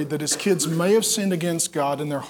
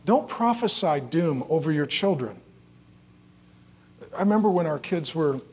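ordinary talk

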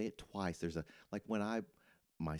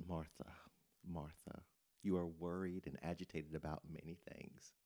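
much softer, gentle, calm rebuke; tender Jesus; 2 semitones lower, 7 dB quieter than usual. Retold or imitated. Jesus gently answering the overwhelmed Martha, tender. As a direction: speak her name softly and lovingly. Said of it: "Jesus says to her".

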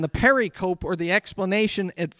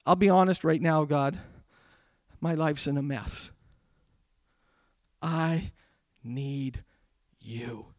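low, hushed confession with long pauses; honest prayer; 4 semitones lower, 8 dB quieter than usual. Acted. Preacher modelling a silent prayer to God, hushed and halting. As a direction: confide it softly to God. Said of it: "just think to him and say".

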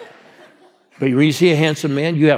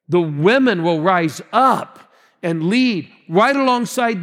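higher-pitched, driving preaching; rising preacher fervor; 6 semitones higher than usual. Acted. Pastor rallying the congregation, fervent preaching. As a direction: press the point home to the crowd.

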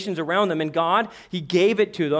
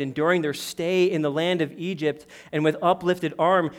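usual speaking voice

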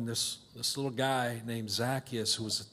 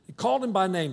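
usual speaking voice

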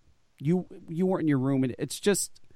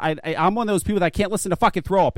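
usual speaking voice

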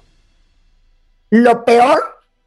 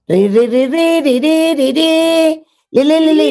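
phone ring imitation; 5 semitones higher, slower than usual.

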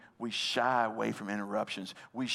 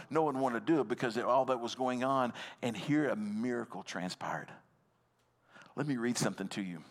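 usual speaking voice

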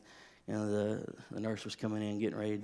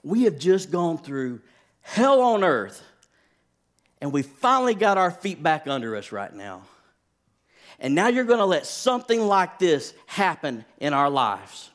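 disbelieving anger; 5 semitones higher, 7 dB louder than usual. Retold or imitated. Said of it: "in my mind, I was like".

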